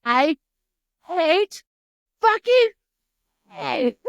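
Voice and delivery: loud, high, one word at a time; mock outrage in isolated bursts; emphatic hatred. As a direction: punch I, HATE, FUCKING and HAY separately, long pauses between. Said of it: "is what he said".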